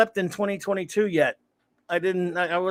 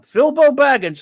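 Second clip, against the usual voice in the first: loud, higher call of the name; announcing a name; 8 semitones higher, 9 dB louder than usual. Pretend. Streamer greets a viewer, booming the name loudly. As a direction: belt the name like calling him into the ring.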